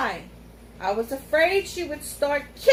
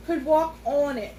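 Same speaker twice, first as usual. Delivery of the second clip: usual speaking voice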